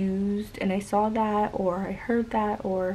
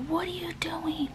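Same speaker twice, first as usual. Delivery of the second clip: whispered mock rage, pitched higher; imitating angry dad; 7 semitones higher, 6 dB quieter than usual. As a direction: hiss out a dad's tantrum under her breath. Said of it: "the dad just got so pissed, and he was like".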